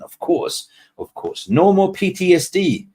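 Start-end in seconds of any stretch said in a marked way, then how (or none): 1.5-2.9 higher, louder and gleeful; excited cheer. Real, not acted. Streamer celebrating with chat that the suffering is over, excited and loud.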